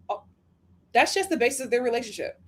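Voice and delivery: ordinary talk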